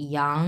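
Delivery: ইয়াং starting low and lifting; drawn, rising-tone ইয়াং; second tone. Shown as a demonstration. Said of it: "এটা দুই number tone".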